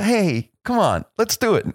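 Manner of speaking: high, eager, coaxing plea; pleading with the guy. Retold or imitated. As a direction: beg the technician in a squeaky rush of hope. Voicing himself pleading with the guy.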